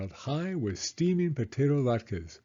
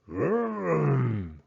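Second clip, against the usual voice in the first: rumbling bear growl; 4 semitones higher than usual. Acted. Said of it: "growled Old Bear".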